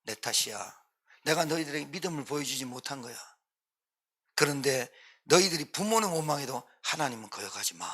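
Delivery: put-on soft, low confession to one's children; 자녀에게 타이름. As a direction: take the blame quietly, then gently urge them. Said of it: "자녀에게 이렇게 해야 돼요". (부모가 자녀에게 나직이 타이르듯)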